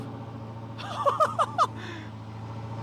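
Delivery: long, high laugh of triumph; elated laugh. Real, not acted. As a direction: laugh out in real delight. Laughs as engine runs.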